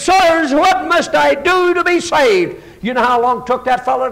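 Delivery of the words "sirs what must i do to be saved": urgent plea. Acted. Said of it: "he has such an attitude that he says".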